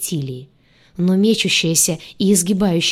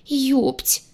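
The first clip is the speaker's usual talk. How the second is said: high, small whimper; involuntary sob; 6 semitones higher than usual. A narrator's reading. Heroine stunned by the idiotic conclusion, involuntary sob. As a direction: let it slip out as a whimper. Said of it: "непроизвольно всхлипнула я".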